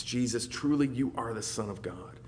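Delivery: ordinary talk, nothing marked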